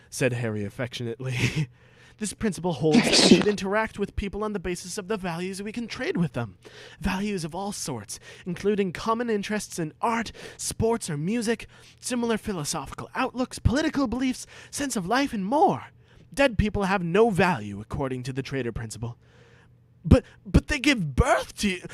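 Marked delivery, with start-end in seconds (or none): 2.2-19.2 put-on lofty preachy boy; prim lecturing Harry, higher. Harry lecturing Ron at the mirror, higher prim. 20.0-21.8 high bewildered plea; pleading Ron, much higher. Acted. Ron pleading with Harry, much higher.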